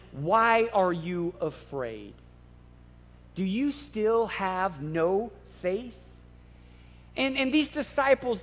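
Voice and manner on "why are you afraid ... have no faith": slow, soft, lower question; gentle question. Retold or imitated. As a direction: gently ask the frightened disciples. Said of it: "he asked them".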